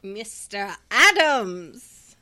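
exaggerated slow German accent; mock German accent, drawn out; bad German accent. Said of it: "That is a very bad German accent".

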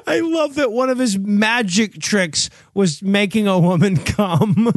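ordinary talk, nothing marked

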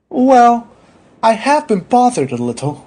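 high, hesitant admission; meek patient voice; reluctant patient. Acted. Patient reluctantly admits a symptom.

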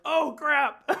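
high, mock-alarmed exclamation; retold dismayed realization. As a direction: act out suddenly realizing your blunder. Retells realizing the mistake.

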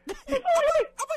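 parroting the exclamation shrilly; mock shout. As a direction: echo his cry in a mock falsetto. Host mimics Severino's cry.

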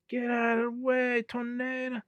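high, softened pretend yell; mock distant yell. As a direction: imitate yelling upward, but keep it soft. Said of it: "he's yelling up to Shiki".

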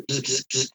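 soft mouth buzz like a faulty lamp; imitated buzzing light. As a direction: buzz quietly through the lips like a flickering beer light. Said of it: "this light started buzzing".

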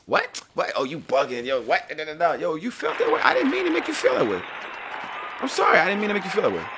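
hammed-up high flustered voice, then a mock apology; mock defensive friend. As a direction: play the guy who gets caught off guard. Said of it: "you ain't gotta be like".

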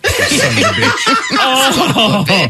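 high, slow, mock outrage while laughing; laughing curse. Real, not acted. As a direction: drag out the insult through laughs. Cursing the woodchuck, laughing.

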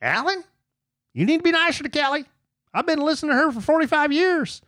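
high, fast whiny fan voice; mocking listener voice. Mocking a listener's message in a high whiny voice. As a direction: squeak it out like a nagging fan. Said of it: "I see people say something like".

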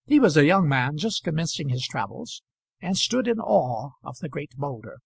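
plain reading voice

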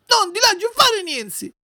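put-on very high, slow childish wail; stubborn wailing. Puppet defying the warning of death, stubborn wailing. Puppet's tantrum over the medicine.

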